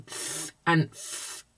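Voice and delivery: "th" and "f" as bare consonant sounds; isolated speech sounds. Shown as a demonstration. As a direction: sound out "th" and "f", slowly, without letter names. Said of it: "the biggest area where I see problems".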